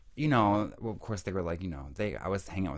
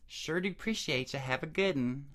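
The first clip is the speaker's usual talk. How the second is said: put-on Tennessee accent; 5 semitones higher than usual. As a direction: imitate locals with a thick Tennessee twang. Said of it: "the accent there is so different".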